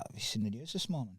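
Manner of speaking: soft deadpan delivery of a retold joke; dead serious. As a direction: imitate a pal breaking grave news, quietly. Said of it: "it was dead serious".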